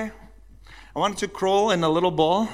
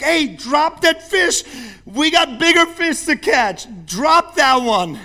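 slow, loud call from across the boat, much higher; 10 semitones higher, 7 dB louder, slower than usual. Retold or imitated. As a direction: shout the order from the deck. Crewmates calling out to him.

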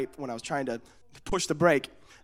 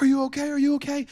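higher-pitched worried fussing; acted concern; 8 semitones higher, faster than usual. Cousins to crashed boy, high mock-worried voice. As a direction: imitate people rushing over anxiously. People rush to fallen child.

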